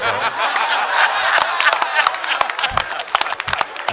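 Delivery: bursting out laughing; can't hold the laugh in; big loud laugh. Real, not acted. Laughing at his own punchline.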